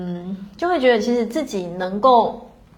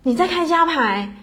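retold, a little higher, as a startled question; wide-eyed surprise; 6 semitones higher than usual. Friends on the street hear she runs family constellation workshops, mildly imitated surprise. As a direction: ask it like you can't believe it. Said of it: "眼睛瞪这么大颗跟我说".

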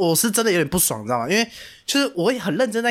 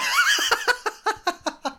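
very high burst of laughter. Real, not acted. Teller laughing at the girlfriend's curt reply. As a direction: laugh at the one-word reply.

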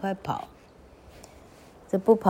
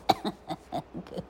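short quiet chuckle; laugh; 9 dB quieter than usual. Real, not acted. Narrator after voicing the angry wife, chuckling. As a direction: laugh softly at your own acting.

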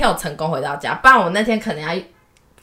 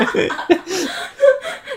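long laugh, much higher. Real, not acted. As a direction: let a long high laugh ride out. Laughing at the brush story. (长长的高声大笑)

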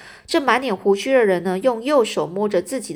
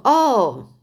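loud oh; 4 semitones higher, 5 dB louder than usual. Acted. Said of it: "不要那么大声叫我的名字啊".

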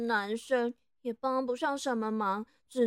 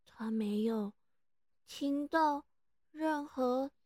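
usual speaking voice

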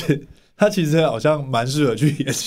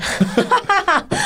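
high burst of laughter. Real, not acted. Co-host teases guest, high laugh. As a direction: laugh out at her friend.

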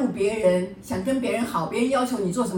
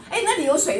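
lightly imitated fussy husband, higher and louder; 8 semitones higher, 5 dB louder than usual. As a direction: play the husband pointing out every drop of water. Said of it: "他就在那边地上说".

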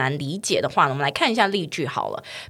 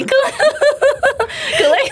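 克雷 chirped high like a bird call; 克雷 as a laughing birdlike squawk; 5 dB louder, much faster than usual. Retold or imitated. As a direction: mimic a bird's cry. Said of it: "很像一种鸟的叫声".

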